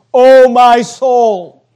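slow and loud; declaimed scripture. Acted. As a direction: stretch it out, calling to the soul. Preacher declaims the psalm's address.

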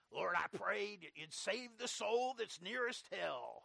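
put-on slow, drawn-out old-time evangelist prayer; Oliver Green. Preacher mimicking an evangelist's closing prayer, slow impersonation. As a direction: stretch out the plea like an old-time radio preacher. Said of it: "That's my Oliver Green impersonation".

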